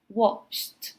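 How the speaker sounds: "watched" ending in T; one syllable, "watched" said as "watcht"; final T sound. Shown as a demonstration. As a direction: finish "watched" on T, no E vowel. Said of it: "we pronounce it with a T".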